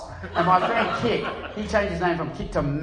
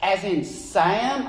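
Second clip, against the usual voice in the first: slow American drawl on a question; mock American accent; 2 semitones higher, much slower than usual. Imitating the official checking the letter, slow American drawl. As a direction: draw out the vowel like an American. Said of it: "they say Sam".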